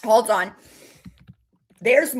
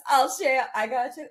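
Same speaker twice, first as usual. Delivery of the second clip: ordinary talk, nothing marked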